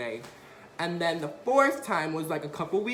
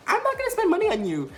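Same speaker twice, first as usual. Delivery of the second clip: mock mom; 13 semitones higher, 5 dB louder, faster than usual. Mom voiced, laughing.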